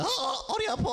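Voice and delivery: put-on high pained yelp of an old woman; lightly acted granny with backache. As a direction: cry out over an aching back. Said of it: "완전 할머니지".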